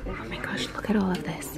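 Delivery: very soft, hushed amazement; amazed whisper. Real, not acted. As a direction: whisper in awe at the sight. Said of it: "me whispering at all this amazing stuff".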